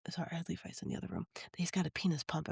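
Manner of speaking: hushed. Real, not acted. Speaker catches herself yelling with someone in the other room, hushed. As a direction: drop the volume right down, discreetly. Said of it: "I just realized I was yelling it".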